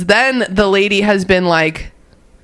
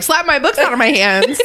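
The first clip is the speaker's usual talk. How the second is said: put-on high, goofy kid voice; silly kid voice; 7 semitones higher than usual. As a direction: play a dumb eleven-year-old. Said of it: "idiot eleven-year-old who's like".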